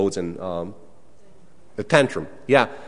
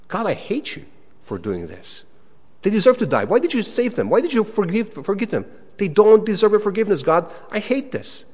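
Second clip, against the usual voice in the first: high, indignant whine at God; angry complaint to God; 8 semitones higher than usual. Pretend. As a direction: protest to God like a sulking prophet. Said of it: "And Rudy says what?".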